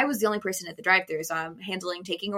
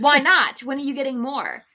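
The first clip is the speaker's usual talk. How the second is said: demanding customer; 5 semitones higher, 6 dB louder than usual. Retold or imitated. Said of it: "customer reply".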